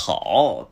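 好 stretched long and forceful; drawn-out 好. Shown as a demonstration. Teacher repeats the second reply, 好 drawn out long. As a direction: hold and bend the vowel of 好. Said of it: "伸ばすんですよ".

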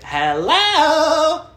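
very high, loud, stretched hello; terrible impersonation of venue worker. Retold or imitated. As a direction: sing out a drawn-out cartoon greeting. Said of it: "That was a terrible impersonation of him".

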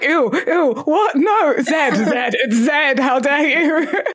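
high, laughing mock outrage; hammed-up indignation through laughter; mock disgust. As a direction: recoil in playful horror. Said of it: "and I hated it".

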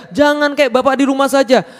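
high-pitched protest to an elder; pleading objection. Acted. Younger man urging grandpa to stay home, high pleading. Acted plea to old man.

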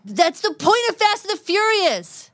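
high-pitched, drawn-out insistence; exasperated protest. Real, not acted. Host defends a movie franchise to co-host, exasperated protest. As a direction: protest it slowly, pitch pushed right up.

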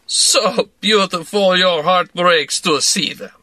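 slow, mock-tragic Russian lament; clownishly tragic. A narrator's reading. Misha clutches his chest in front of the narrator, a slow, mock-tragic lament. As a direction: ham up a broken heart. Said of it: "clownishly tragic face".

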